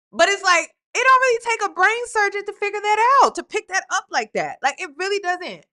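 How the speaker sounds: high, loud and fast exasperation; can't believe how obvious it is; exasperated. Real, not acted. Reactor to the storyteller, loud exasperated disbelief.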